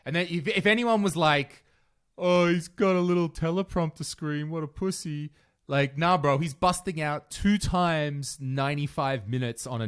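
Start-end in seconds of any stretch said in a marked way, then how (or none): 2.2-5.3 higher-pitched mock jeer; mocking critic. Comedian mimics someone sneering at the teleprompter, mocking.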